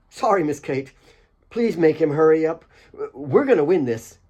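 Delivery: keen lad begging her to hurry; eager young Asif. Acted. Asif pleading with Kate to hurry Alex along.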